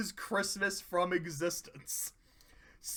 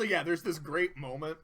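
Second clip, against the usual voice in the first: ordinary talk, nothing marked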